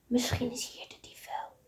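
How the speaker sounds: soft unvoiced whisper. Goes hiding by the bush, whispering to Floor. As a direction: whisper it so the thief can't hear. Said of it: "Goes fluistert".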